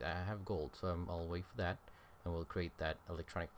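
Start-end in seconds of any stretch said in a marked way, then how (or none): none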